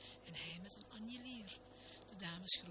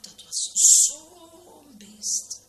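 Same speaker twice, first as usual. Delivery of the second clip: "zo'n" stretched very long, high and loud; boastful stretch; 10 semitones higher, 15 dB louder, much slower than usual. A narrator's reading. Minister bragging about the pike, hugely stretched "zo'n". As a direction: blow up "zo'n" into a huge drawn-out swell.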